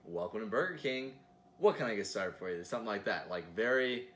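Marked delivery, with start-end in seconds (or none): none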